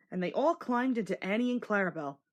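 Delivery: ordinary delivery, nothing marked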